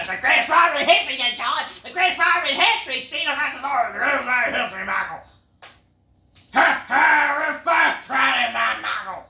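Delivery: fast, loud, high mock hype; hyped promo. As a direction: rattle it off like an overhyped announcer. Mocking hyped Orton promo.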